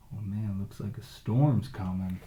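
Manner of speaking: usual speaking voice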